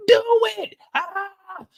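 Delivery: high, wailing half-sobbed screams; desperate yelling. Retold or imitated. Man on the ground begging the gunman, crying high-pitched yell. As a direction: beg him to pull the trigger, crying out.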